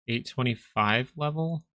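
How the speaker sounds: usual speaking voice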